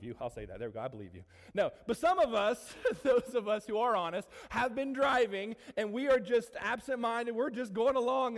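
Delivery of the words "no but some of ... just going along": laughing. Real, not acted. Laughing through driving story.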